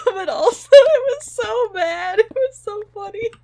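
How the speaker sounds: giggling helplessly; real tearful laughter; laughing. Real, not acted. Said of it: "I'm crying again".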